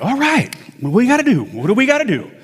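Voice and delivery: high-pitched retold eagerness, pressing for action; gung-ho. Patient answering news of his injury, gung-ho. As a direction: pitch jumps up, ready to tackle it.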